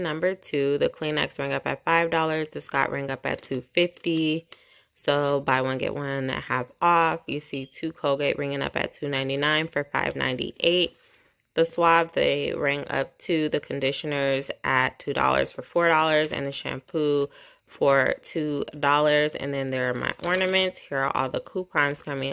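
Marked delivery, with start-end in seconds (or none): none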